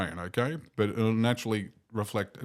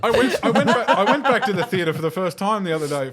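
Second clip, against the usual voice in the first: laughing; 8 semitones higher, 10 dB louder than usual. Real, not acted. Laughing after cinema joke.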